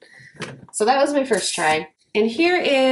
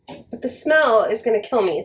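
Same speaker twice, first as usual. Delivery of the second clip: usual speaking voice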